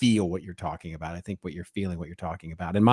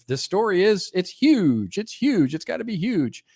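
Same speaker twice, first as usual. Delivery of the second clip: high mocking hype voice; 10 semitones higher than usual. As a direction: parody the breathless media.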